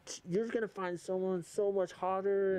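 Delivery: imitating an over-enthusiastic supportive pal; hyped-up consoling. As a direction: pitch it up high to cheer on a dumped friend. Hyping up a dumped missionary friend.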